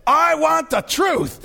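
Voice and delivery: high, slow, impassioned demand; Tom Cruise impression. Lawyer demanding answers from the colonel, high impassioned demand. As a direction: raise the pitch and stretch every word. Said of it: "that famous line where he says".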